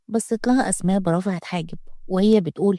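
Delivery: plain reading voice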